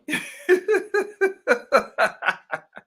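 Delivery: long high laughter; cracking up at the viewer. Real, not acted. Laughs at chatter's joke.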